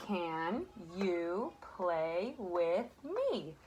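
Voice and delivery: very slow, word by word, like pointing at each word; slow pointing read. Shown as a demonstration. Teacher reading slowly to children, word by word. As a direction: read it slowly, one word at a time for the kids. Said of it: "I'll point and you read".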